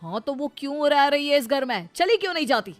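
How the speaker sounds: irritated outburst. Acted. Husband asking mother why first wife stays, irritated. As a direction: push the questions out with annoyance.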